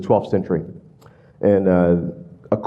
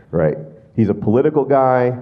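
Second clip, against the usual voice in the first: ordinary talk, nothing marked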